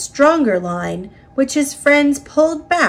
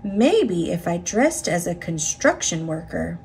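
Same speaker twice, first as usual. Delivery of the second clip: quiet thought; 4 semitones lower, 6 dB quieter than usual. A narrator's reading. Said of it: "he thought".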